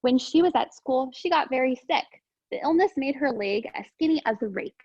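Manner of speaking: gentle storybook read-aloud; calm picture book narration; reading to children. Acted. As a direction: read it simply for young listeners. Reads picture book to class.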